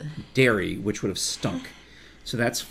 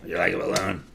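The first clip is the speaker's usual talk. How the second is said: put-on old man voice; 9 semitones higher, 4 dB quieter than usual. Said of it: "Do it, do it".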